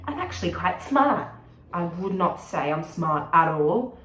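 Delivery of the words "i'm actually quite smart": higher, louder know-it-all imitation; mock self-assured. Mimicking people who say they're not dumb, mock self-assured. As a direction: pitch it up as a boastful parody. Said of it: "every person who says they're not dumb".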